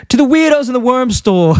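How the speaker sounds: very high, put-on cartoon voice; Homer. Husband answers his doubting wife, mock triumphant. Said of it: "And he goes".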